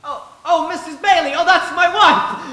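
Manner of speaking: suddenly thrilled to hear from his wife; joyful surprise; excited realization. Acted.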